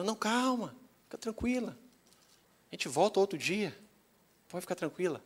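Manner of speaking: soft, lightly high reassurance; gently calm a worked-up woman; hushed, placating retold voice; soothing. Pastor soothing the shouting woman.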